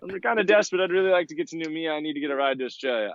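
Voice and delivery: high, fast, eager asking with a laugh; chirpy high-pitched mock begging; hopeful plea. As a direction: sound sweetly desperate for a ride. Begging boats for a ride.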